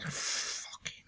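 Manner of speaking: hushed geek reverence, breathless; awed whisper. Retold or imitated. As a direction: whisper it like a holy relic. Geek thrill at retro computer.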